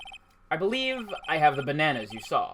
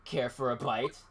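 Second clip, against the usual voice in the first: ordinary talk, nothing marked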